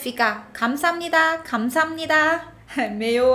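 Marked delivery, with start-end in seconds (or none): 0.5-2.5 감사합니다 dito rápido, letras engolidas; fala rápida. Shown as a demonstration. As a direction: bem rápido, comendo as letras. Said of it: "Quando fala rápido, fica". (감사합니다 corrido, como na fala natural)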